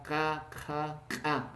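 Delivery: slow postvelar KA, back in the throat; consonant pronunciation drill. Shown as a demonstration. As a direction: sound out each postvelar KA, held out. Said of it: "pronunciando estos consonantes".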